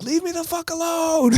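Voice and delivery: high, strained mock shriek; exasperated outburst. DJ acting out his fed-up reaction, high and strained. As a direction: squeal it like you've had enough. DJ venting at the harassing girl.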